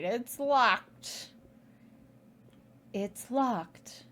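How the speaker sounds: lightly put-on game detective voice; a hint of a girl sleuth, drawn out; mildly acted adventure-game heroine; Nancy Drew voice. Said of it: "That's my best Nancy Drew voice".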